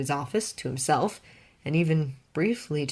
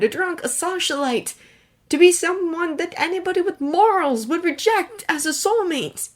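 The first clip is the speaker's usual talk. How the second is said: higher, louder cruel questioning; cruel Clark; 12 semitones higher, 5 dB louder than usual. A narrator's reading. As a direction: lash out, smarting. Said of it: "His tone was cruel".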